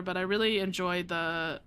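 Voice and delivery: ordinary talk, nothing marked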